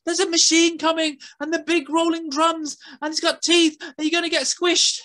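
put-on high, frantic girl pleading; girl begging the boy to save the squirrel. A narrator's reading. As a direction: beg him to hurry. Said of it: "what she was saying".

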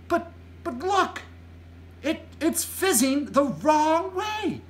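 put-on high, stammering little girl; high-pitched amazed exclamation; Sophie's startled cry. A narrator's reading. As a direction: cry out in alarm, stretching the last words. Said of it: "Sophie cried".

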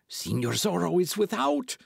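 high, urgent innkeeper voice; hissed warning. A narrator's reading. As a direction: warn the tavern in a panicked rush. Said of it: "he hissed at those nearest the table".

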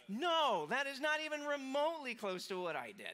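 nearly shouting the denial; preacher bursting out in front of the crowd; emphatic shout. Real, not acted. Preacher denies with force.

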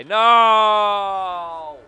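sudden shouted no; loud frustrated no. Real, not acted. Gamer yelling at the screen in loud dismay. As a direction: yell it out in dismay. Missed chance, loud cry.

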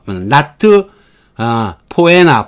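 normal talking voice

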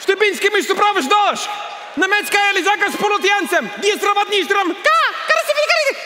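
frenzied mock-Russian shouting. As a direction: shriek wildly like Russians storming the buffet. Russians going wild at the buffet.

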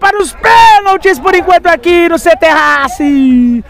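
high, slow goal call; explosive goal commentary. Real, not acted. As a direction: sing out the equalizer. Goal celebration continues.

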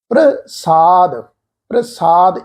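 ਪ੍ਰਸਾਦ as "pra-saad", pr as one sound; joined pronunciation. Shown as a demonstration. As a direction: draw out ਪ੍ਰਸਾਦ with P and R blended. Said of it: "ਇਕੱਠਾ pronounce ਕਰਾਂਗੇ". (ਪ੍ਰਸਾਦ, "ਪ੍ਰ" ਇਕੱਠਾ ਬੋਲ ਕੇ)

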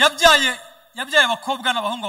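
loud, rising sermon cry; fiery preacher proclaiming; preaching at full force. Acted. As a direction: preach it out hard to the congregation. Preacher proclaiming new birth.

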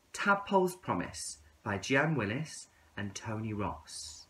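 ordinary delivery, nothing marked

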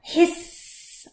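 long, breathless snake hiss; a sneaky snake hiss for the children. Acted. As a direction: hiss like a boa constrictor. Snake sound in picture book.

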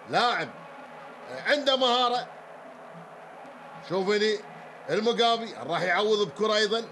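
usual speaking voice

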